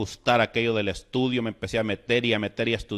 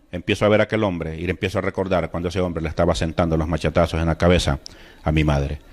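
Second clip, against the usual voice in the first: usual speaking voice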